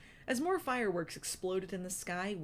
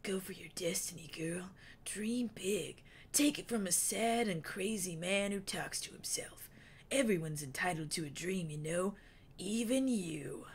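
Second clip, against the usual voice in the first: Wingtip whispering. A narrator's reading. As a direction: hush it like a secret blessing. Wingtip's held voice.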